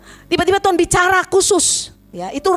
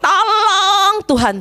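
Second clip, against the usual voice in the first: drawn-out mock cry to God, higher; retold cry out; 6 semitones higher, much slower than usual. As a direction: re-enact calling out, stretching the plea. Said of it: "saya sampai teriak gini".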